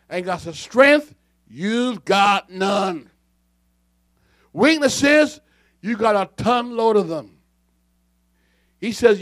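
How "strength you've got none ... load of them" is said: loud, raised pulpit rebuke; reading the riot act. Acted. Preacher voicing God to Laodicea, riot-act rebuke. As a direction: rebuke them, punching the first words. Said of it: "he's telling her the riot act".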